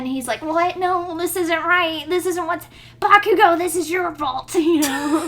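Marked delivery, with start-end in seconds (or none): 0.4-4.5 high, loud disbelief building to a shout; hammed-up panicked outburst; frantic blame. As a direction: spiral from shocked denial into yelling accusation. Shaking Deku blames Bakugo.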